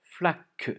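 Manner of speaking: "Flaque" loud and high, both syllables spelled out; dictation emphasis. Shown as a demonstration. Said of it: "deux syllabes dans flaque".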